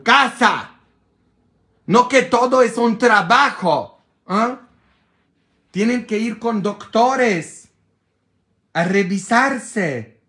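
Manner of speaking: loud, high, exasperated lecture; fed-up scolding. Retold or imitated. Priest scolding a woman about her husband's disease.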